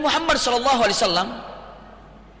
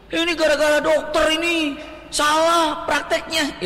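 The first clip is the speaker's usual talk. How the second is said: demonstrated lament; 7 semitones higher, slower than usual. Shown as a demonstration. Preacher showing forbidden wailing that blames the doctor, mock lament. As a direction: lament aloud, dragging out the blame. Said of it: "Meratap itu seperti ini".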